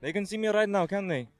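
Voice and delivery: put-on high-pitched Borat-style accent. Guest asks host whether viewers can see, Borat accent. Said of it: "My Borat accent".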